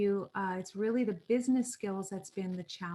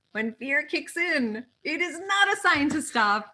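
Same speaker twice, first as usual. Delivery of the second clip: rallying cry; 6 semitones higher, 7 dB louder than usual. Real, not acted. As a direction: fire them up, each phrase bigger. Rallying crowd against self-doubt.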